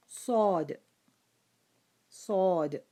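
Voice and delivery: صاد called out loud and heavy; strong letter name. Shown as a demonstration. Teacher introducing the heavy letter to students: صاد. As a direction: boom out صاد. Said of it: "Next letter is the".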